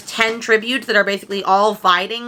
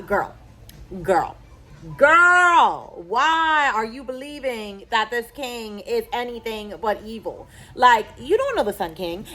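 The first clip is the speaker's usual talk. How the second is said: exasperated scolding; slower than usual. Real, not acted. Scolding the heroine.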